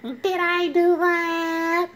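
the sheepish nerd owning up to his mess; Steve Urkel catchphrase. Retold or imitated. Vlogger doing Steve Urkel's famous catchphrase, high and slow. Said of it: "He was like".

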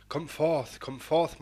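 the wind calling the rider out, loud and gleeful; rebel cry. Acted. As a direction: call out with wild glee into the night. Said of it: "cried in rebel glee".

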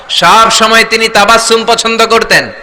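ordinary talk, nothing marked

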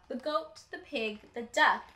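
usual speaking voice

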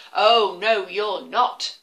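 loud troll refusal. Acted. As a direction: bellow like a troll. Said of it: "said the Troll".

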